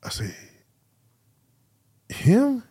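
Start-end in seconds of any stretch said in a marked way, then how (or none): 2.1-2.6 one high, louder incredulous "him?"; "him?" jumps way up in shock; stunned disbelief. Retold or imitated. Shocked the starter got cut.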